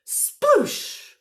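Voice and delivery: high, loud, explosive splash; dramatic storybook sploosh; splash sound word. A narrator's reading. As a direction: burst it out like a big splash. Splash noise acted in story.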